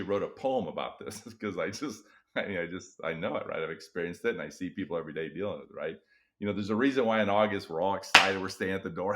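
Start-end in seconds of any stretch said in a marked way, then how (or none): none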